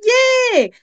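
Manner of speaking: put-on high-pitched kid's cheer; a big, drawn-out happy yay; imitated cheer. Kids thrilled about eba tonight, imitated cheer.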